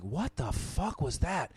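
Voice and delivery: slow, hushed mock incredulity; quietly appalled, retold for laughs; stunned disbelief. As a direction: drop the volume and stretch out the question. Retells stopping the show, stunned.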